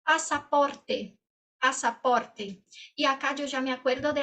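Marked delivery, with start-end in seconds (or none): 0.0-1.2 "Pasaporte" said syllable by syllable, slowly; slow, clear "Pasaporte". Shown as a demonstration. Teacher modelling "Pasaporte" slowly for students. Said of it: "saída". 1.6-2.7 "pasaporte" said syllable by syllable, slowly; slow, clear "pasaporte". Shown as a demonstration. Teacher repeating "pasaporte" slowly for students. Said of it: "saída".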